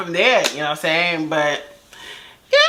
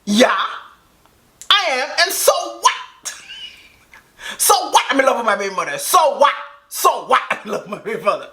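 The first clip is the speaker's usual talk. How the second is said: defiant "so what"; 3 semitones higher, faster than usual. Retold or imitated. He shouts at the girls on speakerphone, defiant. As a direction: throw it back at her. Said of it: "He go".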